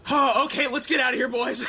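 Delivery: high, loud call to the group; shouted rallying call. Acted. Leader rallying the boys to get out, high loud shout. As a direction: shout to get everyone moving. Rallying party to flee the city.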